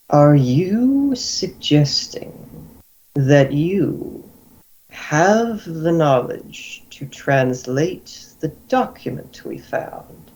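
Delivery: very slow, drawn-out questioning; deliberate, contemplative Guildmaster. Acted. Guildmaster questions a captive's claim to translate the document, contemplative and slow. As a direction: weigh each phrase with long pauses.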